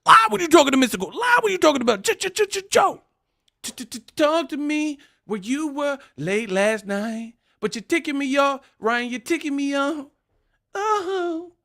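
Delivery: high, fast stammering rant; stuttering impression. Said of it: "But he sound like".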